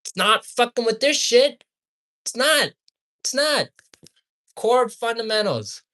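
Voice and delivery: high, loud, exasperated outburst; heated insistence. Real, not acted. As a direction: hammer each phrase in frustration. Heated rant at the companies.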